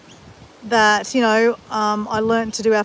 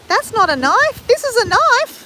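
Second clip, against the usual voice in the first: badly faked Australian movie accent, much higher and louder; bad Crocodile Dundee accent; 13 semitones higher, 5 dB louder than usual. Doing Crocodile Dundee, badly faked Australian accent. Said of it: "That's nowhere near the right accent".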